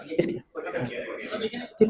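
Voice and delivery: hushed aside to one student; whispered aside. Real, not acted. Teacher whispers to a student mid-lesson, hushed. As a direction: drop to a whisper. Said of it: "aside to student".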